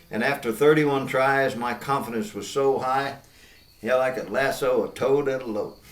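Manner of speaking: cowboy poem recitation; reciting a rhyming ranch poem with a twang. Acted.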